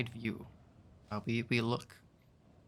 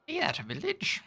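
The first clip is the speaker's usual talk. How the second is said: much higher, chirpy little creature voice; tiny creature voice; 11 semitones higher than usual. Acted. Tiny creature Jan greets the traveler in a high squeak. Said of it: "I'm gonna stop this voice".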